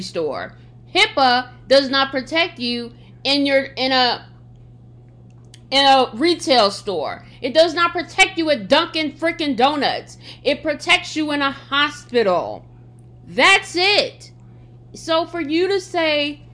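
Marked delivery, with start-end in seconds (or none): none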